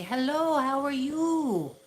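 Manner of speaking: high, loud, drawn-out mock greeting; imitating excited Thai greeters. Thai locals proudly showing off their English, high drawn-out greeting. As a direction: sing out a proud, stretched hello. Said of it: "So they'll say".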